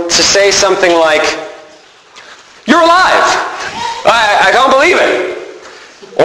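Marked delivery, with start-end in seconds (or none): 2.7-6.2 high-pitched astonished delight; acted amazement. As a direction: act out stunned disbelief, pitch jumping up. Imagines Thomas's amazed reaction.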